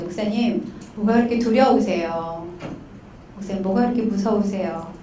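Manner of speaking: low, soft, careful probing; gentle counseling question. Retold or imitated. Counselor asking angry pastor what he fears, low and gentle.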